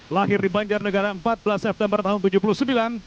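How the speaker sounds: ordinary talk, nothing marked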